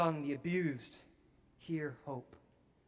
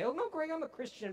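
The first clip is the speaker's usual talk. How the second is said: much higher, mock protesting voice; protesting churchgoer; 11 semitones higher, faster than usual. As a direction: play the defensive half-believer. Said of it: "people who say".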